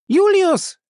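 high, loud call. Acted. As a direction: call his name across the dark. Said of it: "rief sie".

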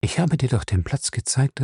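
ordinary delivery

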